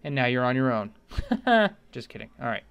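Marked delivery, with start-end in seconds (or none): 1.1-1.7 short loud taunting laugh; mocking laugh. Tutorial host laughs at stranded viewers, mocking. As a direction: cackle at the viewer you just abandoned. Mocks viewer left on own.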